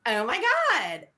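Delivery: put-on slow, high Valley Girl gush. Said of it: "Valley Girl came out, everybody was like".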